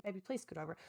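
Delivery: softly pleading, barely voiced; quiet sleepy plea. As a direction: ask him gently in a low murmur so as not to fully wake him. Sleepy plea to husband at night.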